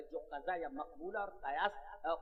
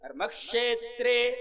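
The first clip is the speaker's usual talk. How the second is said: slow recitation; 3 semitones higher, 8 dB louder, slower than usual. Shown as a demonstration. Teacher demonstrating the verse to students, slow chanted recitation. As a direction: chant the Sanskrit slowly, loud and drawn out. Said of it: "چلیے پڑھ لیتے ہیں".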